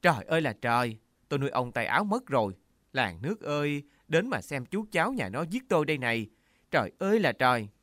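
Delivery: high, loud hammed-up wail; aunt on the ground wailing loud and high to the neighbours; wailing lament. A narrator's reading. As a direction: cry out to the whole village for pity. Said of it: "Thím gào lên".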